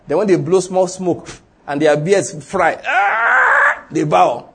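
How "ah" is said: mock pained yell. Men in fire, beards frying.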